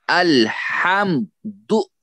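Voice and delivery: "अल्हम्दु" very slow, high, as अल-हम-दु; broken into syllables. Shown as a demonstration. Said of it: "तो अलग अलग करके हम तोड़ेंगे". (अल्हम्दु को धीरे, तोड़कर, ऊँचे सुर में)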